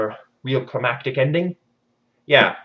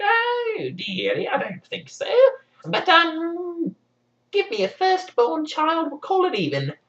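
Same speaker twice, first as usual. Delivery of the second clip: much higher, slow sing-song goblin voice; higher creepy imp voice; sly imp; slower than usual. Acted. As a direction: purr the bargain like a trickster. Rumpelstiltskin bargaining.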